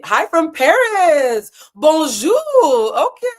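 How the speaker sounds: high, loud sing-song hello; a loud, high, playful bonjour; excited greeting. Acted. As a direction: greet Paris brightly, way up high. Reading a Paris viewer's greeting.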